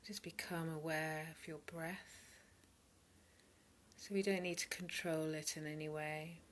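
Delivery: calm meditation voice, spacious pauses between phrases; guided meditation. Real, not acted. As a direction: softly lead listeners into body and breath. Leads a meditation.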